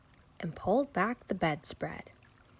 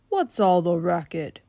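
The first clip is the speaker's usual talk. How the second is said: dad voice; 5 dB louder than usual. Acted. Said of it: "he said with a grin".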